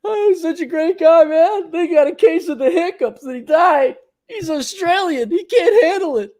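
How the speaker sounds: high, hammed-up mocking voice; mock the friend's weakness sweetly; sarcastic mock praise. Teasing an Australian friend in a high sarcastic voice.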